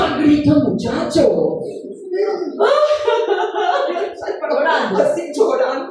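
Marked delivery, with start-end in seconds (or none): 1.7-4.5 high-pitched burst of laughter; real high laugh. Real, not acted. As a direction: laugh at your own story. Laughing at own story.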